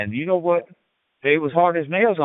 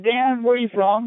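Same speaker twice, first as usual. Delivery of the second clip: voicing the boss, higher; lightly voiced boss, pitched up; the boss asking, raised pitch; boss quoting; 11 semitones higher than usual. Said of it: "he said".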